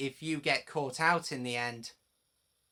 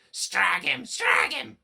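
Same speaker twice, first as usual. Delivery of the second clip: much louder, gleeful truck yell; 2 semitones higher, 9 dB louder, slower than usual. A narrator's reading. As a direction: shout for revenge on the engine. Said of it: "they yelled".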